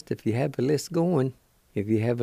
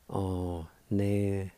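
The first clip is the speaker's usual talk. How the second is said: slow syllables; 4 dB quieter, much slower than usual. Shown as a demonstration. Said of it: "Second part".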